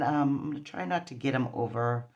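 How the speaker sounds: usual speaking voice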